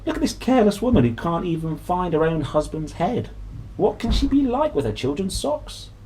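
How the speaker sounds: a hint of a sneering neighbour; imagined gossiping voice. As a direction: sniff at the careless mother, mildly mocking. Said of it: "She could imagine people saying".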